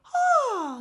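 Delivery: high, pretty head-voice "Ah". Shown as a demonstration. Teacher shows students the head voice. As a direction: float a high "Ah". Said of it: "the voice where you say".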